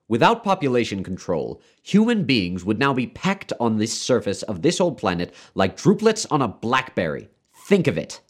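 high, insistent doctor's lecture; emphatic, hectoring argument; severe lecturing. A narrator's reading. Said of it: "became rather severe with Wailing".

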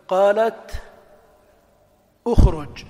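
قالتُ اخرج or قالتِ اخرج, clearly joined; linking vowel demo. Shown as a demonstration. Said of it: "قال تخرج أو الكسر قال تخرج".

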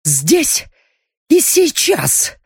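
high, slow, furious growl. A narrator's reading. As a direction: bite out each word in rage. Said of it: "прорычала воительница".

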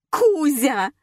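high, loud owner's cry; acted wail for a lost pet; wailing call. A narrator's reading. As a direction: yelp the pet's name in alarm. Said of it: "завопила хозяйка".

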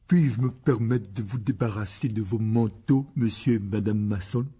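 slow, mannered rehearsed courtesy; false, affected politeness. A narrator's reading. Son rehearsing taking the guests' coats, false and affected. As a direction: put on phony good manners, carefully drawn out. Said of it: "d'une voix fausse et maniérée".

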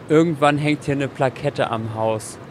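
ordinary delivery

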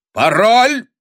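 very loud, high rising shout; forceful, alarmed call; urgent sentry's challenge. A narrator's reading. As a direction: bellow the demand at someone approaching. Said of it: "окликнул темноту часовой".

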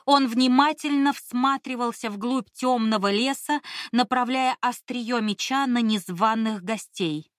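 plain reading voice

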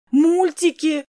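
put-on high, impatient child's shout; demanding child. A narrator's reading. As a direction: demand it loudly like a small child. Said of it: "требовал ребёнок".

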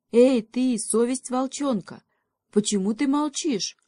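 high, demanding hail; challenging, high-pitched summons; calling out. A narrator's reading. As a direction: call across to someone who won't answer, loud on Эй. Calling out to the conscience.

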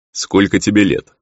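loud, sudden demon's question; abrupt question. A narrator's reading. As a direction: ask it out of the blue, with force. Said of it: "неожиданно спросил хозяин".

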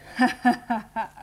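a short, high, challenging laugh; defiant laugh. Acted. Girl hauled upright laughing at the onlookers, defiant laugh. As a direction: laugh to dare them all to stare.

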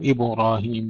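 "إبراهيم" said as ibu-rahim; mistaken u after ba. Shown as a demonstration. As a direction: round the ba of "إبراهيم" into a short u. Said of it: "بعض الإخوة يضمونها".

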